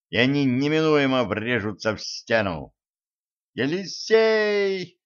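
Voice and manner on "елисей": high, long-drawn call; drawn-out yell. A narrator's reading. Zhenka spots the tied-up prince and yells out. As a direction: cry out the name, stretching it. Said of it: "заорала Женька".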